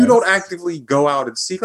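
ordinary talk

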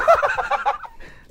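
squealing laugh up high; cracked-up high laugh at a friend's joke; high burst of laughter. Real, not acted.

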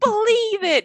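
high, loud boyish cartoon catchphrase; Naruto. Fan imitating a voice actress, boyish cartoon voice. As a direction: make it boyish and punchy, way up high. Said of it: "make it sound more boyish".

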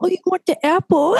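high-pitched mock mommy voice; mom talking to baby. Listener mimicking a mom offering an apple, high baby talk. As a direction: imitate a mom cooing to her baby. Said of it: "like a mom talking to her baby".

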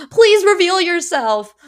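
loud, slow, high call out to the unknown giver; mock pleading call. Host calling out to the secret gift giver, loud, high and slow. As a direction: cry out dramatically for them to show themselves.

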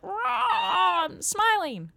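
high, sudden mock-hyped voice; mock manic grin. Comic mocking a smiler high on Adderall. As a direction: play the pill kicking in.

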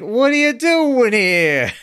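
high, slow, drawn-out nasal whine; squeaky Squiggy voice. Retold or imitated. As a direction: stretch it out high like a goofy sidekick.

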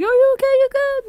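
high, loud, worried check-in; concerned friend. Retold or imitated. Friend asks the yawning listener if they're okay, high and loud. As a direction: call out to a yawning friend who looks off. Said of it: "They're like".

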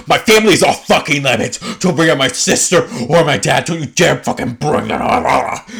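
loud, high, hammed-up screaming rant; terrifying furious yell. Podcaster imitates castmate warning others off her family, furious screaming. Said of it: "like, the most terrifying thing".

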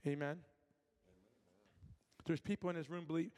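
normal talking voice

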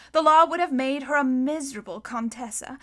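usual speaking voice

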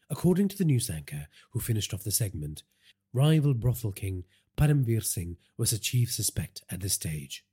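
usual speaking voice

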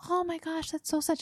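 high, soft, overly pitying; pitiful pity. Shown as a demonstration. Friend mocking the pitying reaction, soft and high. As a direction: gush pity like it's a tragedy. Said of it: "don't make it pitiful".

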